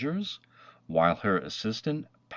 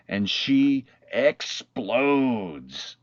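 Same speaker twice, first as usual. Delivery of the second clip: very slow, louder, stretched for drama; drawn-out explosion; 4 semitones higher, 5 dB louder, much slower than usual. A narrator's reading. Narrator building the girl's outburst for children, slow and loud. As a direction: stretch every word out like a blow-up. Girl loses her temper.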